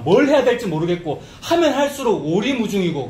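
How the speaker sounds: ordinary talk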